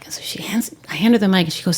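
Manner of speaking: ordinary delivery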